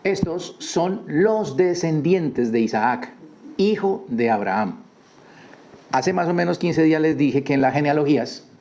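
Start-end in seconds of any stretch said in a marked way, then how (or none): 0.0-4.8 slow, higher, ceremonial reading of the verse; solemn scripture reading. Shown as a demonstration. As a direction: intone the opening verse slowly and solemnly. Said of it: "Escuche lo que dice". (lectura solemne y lenta del versículo)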